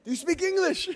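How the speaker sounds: high, loud laughing quote; laughing high question. Retold or imitated. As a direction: laugh through their eager question. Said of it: "I said".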